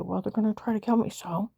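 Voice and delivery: ordinary talk, nothing marked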